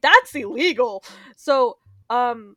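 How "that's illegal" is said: mock appalled. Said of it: "I'm like".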